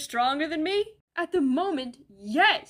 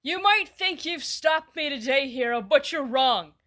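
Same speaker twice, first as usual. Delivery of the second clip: loud, slow villainous boast; villain gloat; 3 semitones higher, 7 dB louder than usual. Acted. Villain taunting the hero he beat, loud and slow. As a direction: boom it out like a cartoon villain sneering at the hero.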